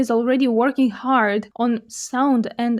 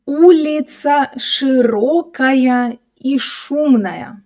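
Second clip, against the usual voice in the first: slow, drawn-out model reading for learners; slower reading; slower than usual. Shown as a demonstration. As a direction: stretch each word out for a learner to follow.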